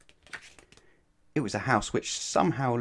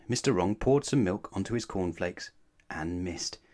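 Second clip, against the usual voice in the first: plain reading voice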